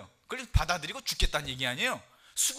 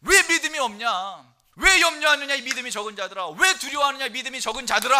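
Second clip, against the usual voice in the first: stern rebuke; 6 semitones higher, 10 dB louder than usual. Retold or imitated. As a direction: drive it home like a master rebuking. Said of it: "하고 꾸짖는 거예요".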